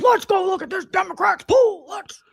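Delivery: high, put-on gushing voice; mock streamer voice. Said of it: "And she's like".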